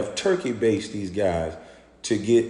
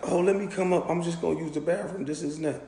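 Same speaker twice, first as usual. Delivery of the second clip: imitating girl; 7 semitones higher, 5 dB quieter, faster than usual. Voicing the girl asking up.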